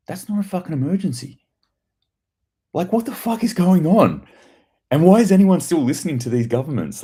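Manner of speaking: raising the voice in disbelief at the government; exasperated rant. Real, not acted. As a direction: vent it, fed up. Rant climax.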